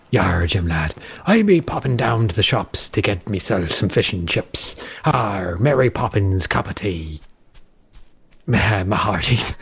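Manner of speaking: low West Country pirate burr; Bristolian pirate accent. Shown as a demonstration. Showing how West Country sounds like pirate, low Bristolian pirate accent. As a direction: play a Bristol pirate, breaking into a laugh. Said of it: "it's, it's a Bristolian accent".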